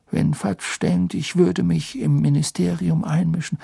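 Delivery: lightly voiced kind old headmaster; Dumbledore voice. A narrator's reading.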